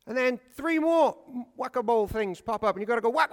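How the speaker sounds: ordinary delivery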